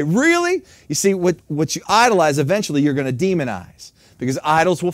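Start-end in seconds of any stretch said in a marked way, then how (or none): 0.0-0.6 big high-pitched disbelief; preacher scoffing at the complaint, high incredulous really. Real, not acted. Preacher reacts to mocked complaint.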